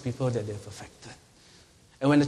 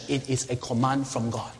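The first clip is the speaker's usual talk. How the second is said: ordinary delivery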